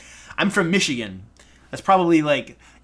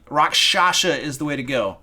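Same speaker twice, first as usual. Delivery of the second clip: normal talking voice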